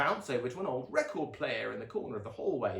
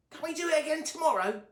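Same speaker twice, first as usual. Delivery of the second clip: put-on much higher, excited boy voice; Sonny dashes inside asking for more, eager high child voice; eager little brother; 6 dB louder, faster than usual. A narrator's reading. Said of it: "added Luna's brother".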